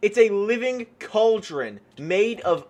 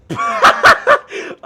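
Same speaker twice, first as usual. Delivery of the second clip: a shrill burst of laughter, much higher and louder; high shrieking laugh; 11 dB louder than usual. Real, not acted. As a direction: cackle out loud with glee. Laughs after firing weapon.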